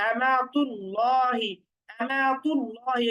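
আমাতুল্লাহি recited slowly with the round ta said as tu; slow Quranic recitation of আমাতুল্লাহি, ta clearly pronounced; slow recitation demo. Shown as a demonstration. Teacher recites slowly so students hear the tu in আমাতুল্লাহি. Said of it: "এখানে তা পেশ তু".

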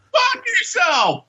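high, slow hammed-up shout; mock angry shout. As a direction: echo the insult with a yell. Echoes retort.

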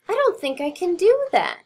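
small high squeaky mouse; high little mouse voice. Acted. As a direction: pitch it up like a tiny mouse.

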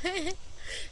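high squeaky giggle; helium giggle. Real, not acted. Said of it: "It's so silly".

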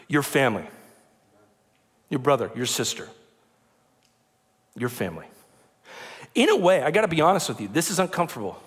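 ordinary delivery

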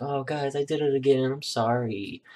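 upset, embarrassed. Retold or imitated. Mom apologizing to the family for her burner mistake, upset and embarrassed. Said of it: "she got all upset and embarrassed".

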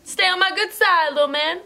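high, loud playful warning; mock stern to puppy. Real, not acted. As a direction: warn the puppy teasingly.

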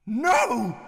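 shout. Acted. Old man grabs the emerald.

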